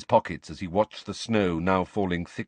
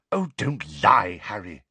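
impatient; 3 dB louder than usual. A narrator's reading. Hermione rebuking Harry for hiding, impatiently. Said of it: "she said impatiently".

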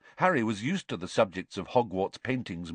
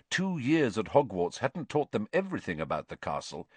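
plain reading voice

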